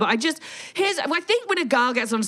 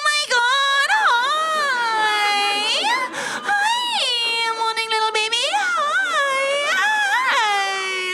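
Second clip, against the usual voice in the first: put-on baby talk; much slower than usual. Mom greeting her baby, squealing sing-song baby talk.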